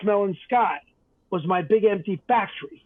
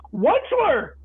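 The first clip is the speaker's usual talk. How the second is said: croaking cry; 10 semitones higher than usual. A narrator's reading. The coughing Lorax crying out at the Once-ler, in a high croak. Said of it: "he cried with a cruffless croak".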